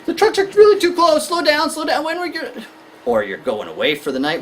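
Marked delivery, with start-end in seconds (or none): 0.0-2.6 put-on high, panicky backseat kid; mock panic, words tumbling out; anxious child. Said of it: "they're like".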